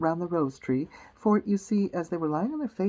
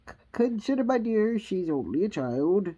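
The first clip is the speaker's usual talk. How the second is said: timid King; 3 semitones higher than usual. Acted. King pleading for the child, timid. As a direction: stammer into a hesitant appeal. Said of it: "timidly he said".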